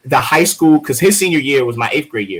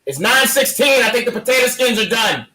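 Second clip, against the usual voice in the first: calling out to mom; 11 semitones higher than usual. Real, not acted. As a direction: call out to the next room, pitched high. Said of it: "Hold on one second".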